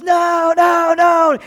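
high, loud wailing no; wailing child. Retold or imitated. Preacher voices a child wailing at punishment, high and loud. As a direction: cry it out like a punished kid.

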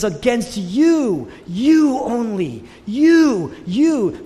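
emotional appeal building in pitch and volume; crying out. Acted. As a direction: imitate an anguished plea, stretching each word. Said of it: "he's crying out to God".

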